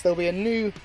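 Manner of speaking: ordinary talk, nothing marked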